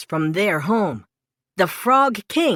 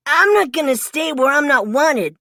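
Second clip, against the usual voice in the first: plain reading voice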